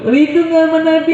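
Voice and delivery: high-pitched call to the congregation; preacher's rousing question. Acted. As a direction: put the question high and keen. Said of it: "baru bilang". (pertanyaan penggugah jemaah bernada tinggi)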